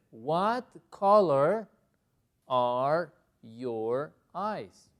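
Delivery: very slow, separated words; word-by-word model. Shown as a demonstration. Teacher introducing a new question, slow and deliberate. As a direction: read it out word by word.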